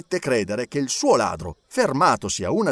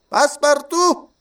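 high, louder roll call rising; clerk calling out; 12 semitones higher, 6 dB louder, slower than usual. A narrator's reading. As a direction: call the name out to the court. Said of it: "rispose subito Passepartout". (cancelliere che fa l'appello, acuto)

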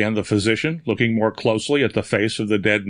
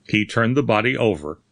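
plain reading voice

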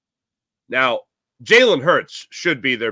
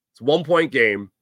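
usual speaking voice

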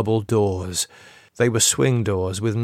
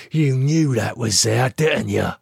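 low cold hatred; 3 semitones higher than usual. A narrator's reading. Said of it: "his voice low and cold with hatred".

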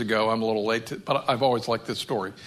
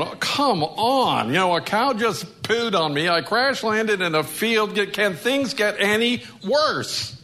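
freaked-out little bird; 6 semitones higher than usual. Retold or imitated. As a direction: whine it like a bird at its wits' end. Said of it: "absolutely freaked out".

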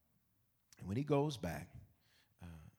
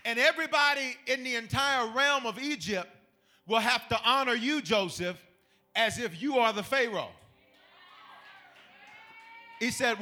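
louder, higher full-force preaching; 10 semitones higher, 10 dB louder than usual. Acted. Preacher driving the point home to the church. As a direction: preach it to the congregation.

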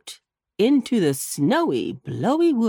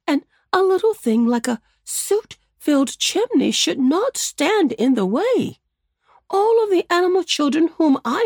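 higher, louder earnest bunny uncle; old rabbit musing; 6 semitones higher, 4 dB louder than usual. Acted. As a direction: resolve to help the boys. Wiggily thinks aloud.

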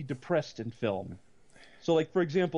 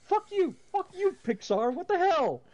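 indignant outburst; 13 semitones higher than usual. Retold or imitated. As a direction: yell it at the screen. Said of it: "I'm like".

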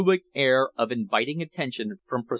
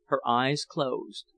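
ordinary delivery

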